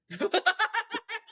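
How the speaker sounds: a bright, high burst of real laughter. Real, not acted. Laughing at God's simple answer.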